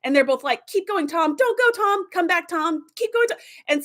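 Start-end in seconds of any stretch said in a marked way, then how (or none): 0.7-3.4 much higher, alternating pleas; tug-of-war voices. Retold or imitated. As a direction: pull him both ways at once. Angel and devil voices tug Tom.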